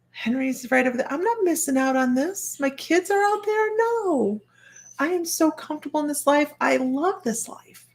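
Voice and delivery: high-pitched, heartfelt protest; retold insistence, voice climbing high; pleading refusal. Acting out her refusal to leave life.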